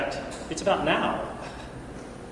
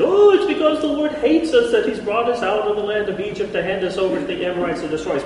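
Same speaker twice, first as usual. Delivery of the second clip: mock complaining Israelites, higher; a hint of grumbling people; whiny grumbler; 6 semitones higher, 4 dB louder than usual. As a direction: whine it like a sulky crowd. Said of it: "and you said".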